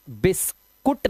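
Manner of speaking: बिस्कुट ending in "koot", u voiced; wrong reading. Shown as a demonstration. Teacher scolds students for misreading biscuit, wrong reading. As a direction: say बिस्कुट slowly as "bis-kut". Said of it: "नहीं पढ़ते इसको".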